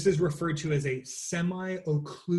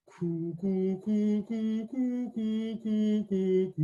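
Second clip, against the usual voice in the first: hollow coo; 6 semitones higher, much slower than usual. Shown as a demonstration. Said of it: "Okay, try that again".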